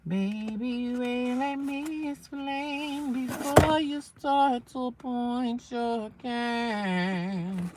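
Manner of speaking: slow, high drawn-out scold; mock scolding. Scolding someone about pointing a cane, slow mock voice. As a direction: draw it out like a put-out auntie.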